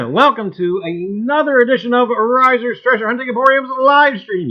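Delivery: loud, high showman welcome; show host intro. Acted. Host opening the live stream to his gang, big showman welcome.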